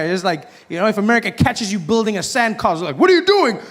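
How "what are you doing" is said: high-pitched, outraged yell; mock angry challenge. America catches someone building a sandcastle, mock outraged yell. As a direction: demand it like an outraged bully, hammed up.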